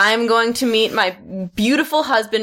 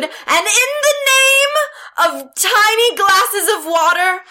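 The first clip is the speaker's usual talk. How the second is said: loud, high heroic transformation speech; magical girl catchphrase; 6 semitones higher, 3 dB louder than usual. Acted. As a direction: proclaim it like Sailor Moon. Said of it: "you have to say your catchphrase".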